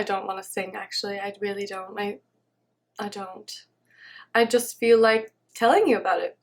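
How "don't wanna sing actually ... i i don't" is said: fading to a murmur. Real, not acted. Backing away from singing, fading.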